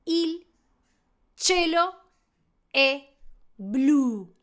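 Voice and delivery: "il cielo è blu" word by word with pauses; Italian example. Shown as a demonstration. Teacher reading the example sentence, spaced "il cielo è blu". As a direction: say "cielo" as CHEH-lo, each word separate. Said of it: "había puesto un ejemplo".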